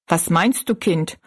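ordinary delivery

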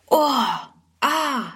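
high, admiring oohs and aahs; amazed. A narrator's reading. Everyone marvelling at the magazine cover, amazed. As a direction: let out two drawn sighs of wonder. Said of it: "staunen dann alle".

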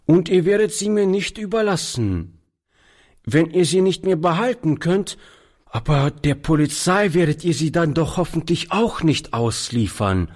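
put-on a boy's high voice, asking anxiously; questioning. A narrator's reading. Boy asking the old man about the police: questioning. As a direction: probe gently for reassurance. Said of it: "setzte er fragend hinzu".

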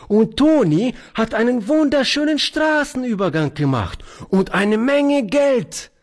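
put-on high child's voice, thrilled; a small girl bragging about Toni; excited little girl. Little girl excitedly telling grandfather about Toni's earnings.

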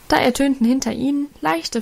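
plain reading voice

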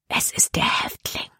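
hushed, urgent whisper. A narrator's reading. Girl warns her companion about the convict, whispering. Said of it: "flüsterte sie Caleb zu".

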